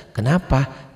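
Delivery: ordinary talk, nothing marked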